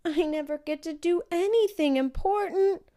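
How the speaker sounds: put-on pouting young girl complaint. A narrator's reading. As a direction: whine it like a sulking little girl. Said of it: "I pouted".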